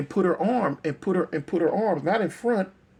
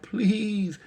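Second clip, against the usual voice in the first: higher, drawn-out imitated plea; tearful plea; 5 semitones higher than usual. Crying girl begging the gunman, mock tearful plea. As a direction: mimic a crying child pleading. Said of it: "And she crying".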